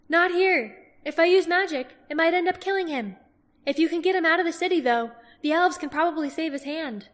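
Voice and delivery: urgent healer's refusal; herbalist. Acted.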